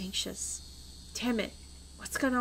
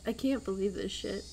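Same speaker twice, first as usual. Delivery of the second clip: ordinary talk, nothing marked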